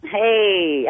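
ordinary talk

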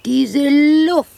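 high, loud rapture over the fresh air; rapturous exclamation. Acted. Woman in her garden gushing over the air, loud and high.

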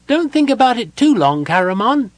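very high, loud character voice; calling out. A narrator's reading. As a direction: call out in a high-pitched little voice. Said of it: "Tass called out".

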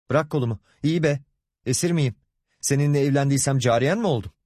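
tiz perdeden isyankâr çıkış; öfkeli isyan. Acted. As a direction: kolunu kurtarırken kocana isyan et. Kadın kolunu kurtarıp isyan ediyor. (kızgın, tiz sitem)

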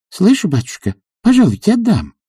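high-pitched eager servant woman; obliging maid. A narrator's reading. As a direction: answer readily in a high voice. Narrator voices obliging maid Anisya.

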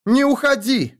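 high, loud plea; desperate cry, pitched high; shouting. Acted. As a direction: shout after him as he leaves. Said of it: "крикнула я".

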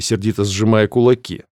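ordinary delivery, nothing marked

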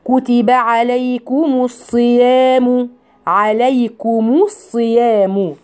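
slow tajweed reading, عليكم with a sounded ضمة on the ميم; slow recitation. Shown as a demonstration. Teacher recites the verse for her students. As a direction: draw the verse out slowly. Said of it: "انتبهي حبيبتي في الله".

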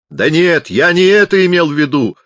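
high, loud, desperate protest. A narrator's reading. Pupser tries to correct the deaf chaplain, desperate. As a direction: cry out to correct a misunderstanding. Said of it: "отчаянно сказал Пупсер".

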